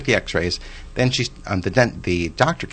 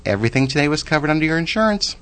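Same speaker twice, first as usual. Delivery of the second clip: cheerful good news; 9 semitones higher, faster than usual. Retold or imitated. Receptionist announces good news.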